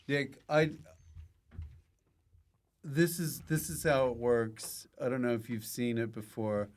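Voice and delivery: usual speaking voice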